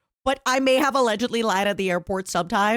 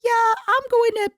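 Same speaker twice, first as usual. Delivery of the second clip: high, put-on breezy cover story; mock cheerful; 10 semitones higher, 4 dB louder than usual. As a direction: chirp an innocent fib to the officer. Acted cover story at airport.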